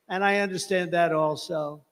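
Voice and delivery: usual speaking voice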